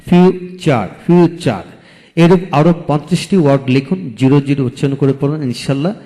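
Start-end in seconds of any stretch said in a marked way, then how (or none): 0.1-1.6 future in two beats, fiu, char; split into syllables. Shown as a demonstration. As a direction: pause after ফিউ in future. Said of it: "দুটি syllable থাকে".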